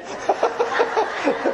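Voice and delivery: high burst of real laughter; loud, high delighted laugh. Real, not acted. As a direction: break into a big unvoiced laugh. Laughing at his own joke.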